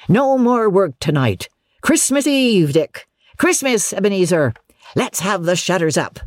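high, merry boss's voice; jovial calling out. Acted. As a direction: call festive orders to the apprentices. Fezziwig merrily ordering apprentices.

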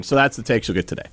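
ordinary delivery

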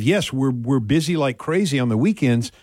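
normal talking voice

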